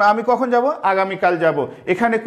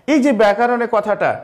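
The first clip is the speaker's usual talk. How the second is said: normal talking voice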